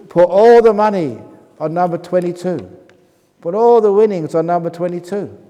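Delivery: excited insistent voice; eager urging. Retold or imitated. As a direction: push him to bet it all. Voice urges bigger bet.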